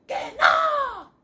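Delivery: grito agudísimo de rana; la rana grita que no, chillando; rana gritando. Acted. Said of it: "La ranita le contesta otra vez". (rana harta grita con voz muy aguda)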